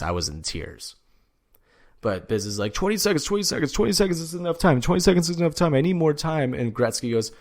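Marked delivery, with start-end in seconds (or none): 2.7-6.5 high, fast mock panic; flustered protest. Imitating Biz begging for more time, flustered.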